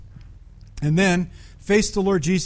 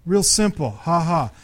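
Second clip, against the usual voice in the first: ordinary talk, nothing marked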